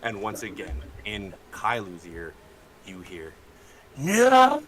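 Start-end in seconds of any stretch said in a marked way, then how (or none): 4.0-4.6 piercing high villain shriek; shrill scream. Acted. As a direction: scream it out loud. Villain scream ends the session.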